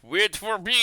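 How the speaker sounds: put-on high-pitched Japanese accent. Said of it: "That's my Japanese accent".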